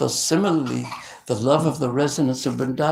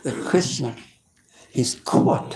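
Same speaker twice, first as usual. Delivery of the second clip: ordinary delivery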